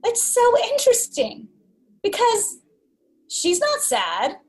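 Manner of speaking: high, bemused commentary on her own tears; mock amused observer. Friend mimicking her drunk self, high and bemused. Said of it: "looking at that saying".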